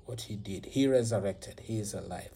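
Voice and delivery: ordinary delivery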